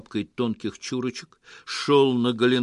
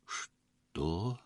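soft, low, incredulous question; tentative, barely voiced shock; hushed disbelief; 4 semitones lower, 9 dB quieter than usual. A narrator's reading. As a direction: ask softly, not daring to believe. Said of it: "ещё не смея верить".

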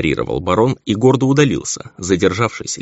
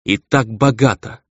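slow, higher-pitched bitter retort; bitter exclamation; 4 semitones higher than usual. A narrator's reading. Baroness retorts about her money, bitterly. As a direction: draw it out with bitterness. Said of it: "с горечью воскликнула леди".

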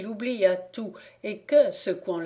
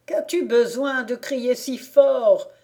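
higher, louder put-on voice; disguised voice; 5 semitones higher, 7 dB louder than usual. A narrator's reading. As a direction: protest in a faked voice. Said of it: "dit-il en contrefaisant sa voix".

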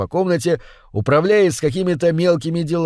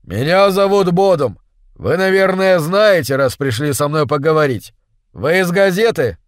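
near-shouting; 3 semitones higher, 3 dB louder than usual. Acted. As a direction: half-shout over the roar. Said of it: "Он почти выкрикивал слова". (почти кричит)

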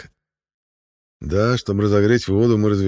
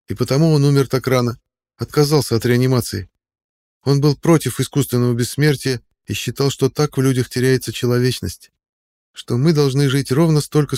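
put-on higher, louder young friend; young man voice; 6 semitones higher, 5 dB louder than usual. A narrator's reading. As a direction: speak up as the earnest friend. Character Tonni speaking.

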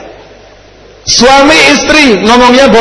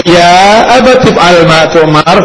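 slow Arabic recitation; 4 semitones lower, much slower than usual. Retold or imitated. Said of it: "Si anak juga jawab".

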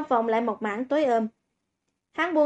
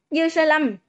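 mildly raised, higher-pitched call; 4 semitones higher than usual. A narrator's reading. Humiliated student calls after the boy walking away, mildly raised voice. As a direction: call the name out in sudden anger, held back. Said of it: "đột nhiên cao giọng mở miệng hô to".

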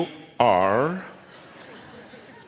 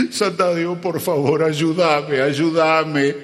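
higher, fervent plea to the saint with a laugh in it; pleading prayer; 4 semitones higher, slower than usual. Acted. Businessman prays to Sandádivo before the choir, pleading. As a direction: beg the saint for help, half-hammed. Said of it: "le reza a Sandádivo".

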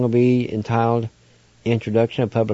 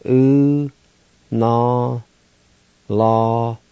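ordinary talk

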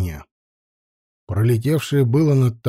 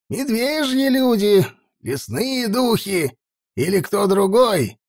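put-on old elder voice; 6 semitones higher than usual.

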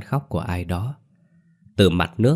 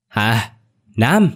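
raised, louder call with urgency; hoarse yell; 3 semitones higher, 5 dB louder than usual. A narrator's reading. Grandmother searching for the two youngsters, hoarse yell. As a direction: cry out urgently, as if hoarse from haste. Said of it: "Bà nội hét lên, giọng khản đặc".

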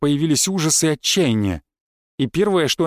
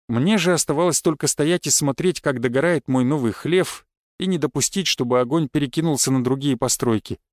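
plain reading voice

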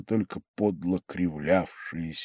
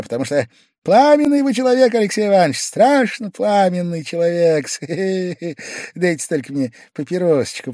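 high, loud, needling confession; sly taunting; 9 semitones higher, 7 dB louder than usual. A narrator's reading. Pavel Pavlovich teasing Alexei Ivanovich, high and loud. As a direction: savour teasing his fiery host.

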